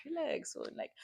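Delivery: nonchalant whispered brush-off; breathy indifferent mumble; dismissive cool. Retold or imitated. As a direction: act too cool to care. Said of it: "I'd be like".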